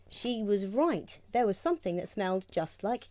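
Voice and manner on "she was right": plain reading voice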